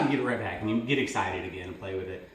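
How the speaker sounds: usual speaking voice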